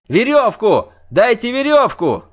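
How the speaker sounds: very high, loud cries for help; shouting. A narrator's reading. Bard begs bystanders for a rope, shouting. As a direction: yell desperately for a rope. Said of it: "кричал Лютик".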